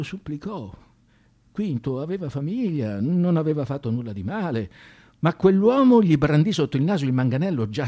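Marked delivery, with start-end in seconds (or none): none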